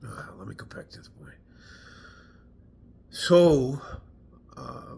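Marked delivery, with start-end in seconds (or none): 0.1-1.3 fast, much softer whisper; muttered aside. Real, not acted. Veteran steering back to his story in a whisper. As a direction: say it under your breath, hurrying on.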